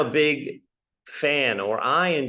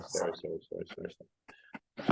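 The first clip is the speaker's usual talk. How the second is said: apologetic whisper; 6 semitones lower, 13 dB quieter, faster than usual. Real, not acted. Fumbling apology while fixing screen.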